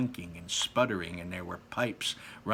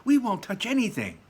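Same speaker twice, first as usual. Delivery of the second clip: put-on children's voices; 11 semitones higher, 7 dB louder than usual. Children promising together.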